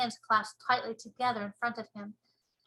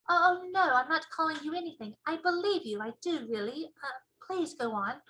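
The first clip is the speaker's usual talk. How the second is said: put-on little girl voice; 5 semitones higher than usual. Sophie apologizing.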